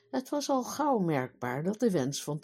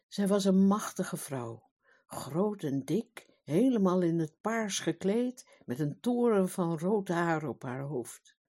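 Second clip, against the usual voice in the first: ordinary delivery, nothing marked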